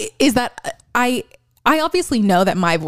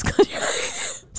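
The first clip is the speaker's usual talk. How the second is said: soft, slow whisper; whispered coaching; 6 dB quieter, much slower than usual. Shown as a demonstration. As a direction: breathe it out slowly.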